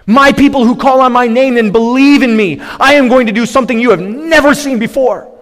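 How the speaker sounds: high, loud, emphatic declaration; attention-grabbing proclamation. Acted. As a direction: call out to grab a whole crowd's attention. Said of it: "This is to grab attention and to say".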